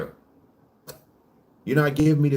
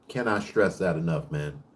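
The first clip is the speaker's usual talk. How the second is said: usual speaking voice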